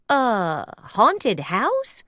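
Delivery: slow, puzzled Mickey question. Acted. Mickey puzzled by talk of a haunted house. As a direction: wonder what they mean. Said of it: "A puzzled Mickey".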